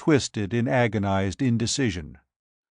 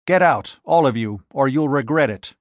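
angry order; 2 semitones higher, 4 dB louder than usual. Acted. Court driving off the mob, angry and louder. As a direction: bark them off the porch.

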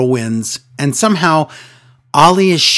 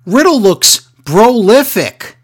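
lightly voiced smug commentator, higher, slowing at the end; a hint of a self-pleased announcer; smug Byron impression; 7 semitones higher, 4 dB louder than usual. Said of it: "He goes".

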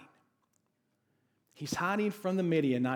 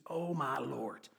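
drawn out, softer exasperated sigh of a phrase; sarcastic; 6 dB quieter, slower than usual. Shown as a demonstration. Preacher showing how he says it at home, sarcastic and slow. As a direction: let it out slowly, fed up and mocking. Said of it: "it's done out of sarcasm, it's done out of frustration".

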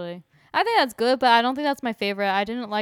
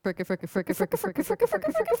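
rapid "fricka" stutter climbing much higher; imitated record scratch stutter; 9 semitones higher, 5 dB quieter, faster than usual. Co-host performing the segment jingle live, stuttered scratch imitation. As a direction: repeat it like a scratched intro, rising. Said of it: "we just heard it".

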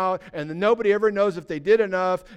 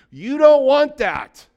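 preached warning; 6 semitones higher, 8 dB louder, slower than usual. Real, not acted. Preacher warning of fiery judgment.

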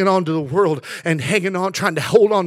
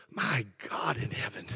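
much softer, lower, intimate appeal; hushed preaching; 5 semitones lower, 15 dB quieter than usual. Acted. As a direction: drop to a near hush. Sudden hush after the climax.

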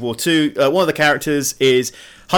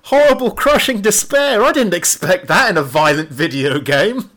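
mock dismay; 8 semitones higher than usual. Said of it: "That's supposed to be like".

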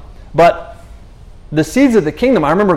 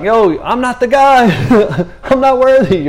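mock protest; 7 semitones higher, 3 dB louder than usual. Jeremiah protesting to God.

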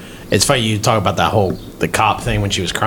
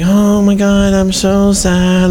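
high, loud, drawn-out fake sob voice; mock teary whine; 10 semitones higher, 5 dB louder, slower than usual. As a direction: ham up the ex's crocodile tears. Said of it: "fucking all teary-eyed".

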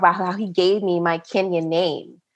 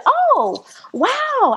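much higher oh wow, drawn out; pitched-way-up polite wow; polite surprise. Retold or imitated. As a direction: react as if impressed. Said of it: "And I said".

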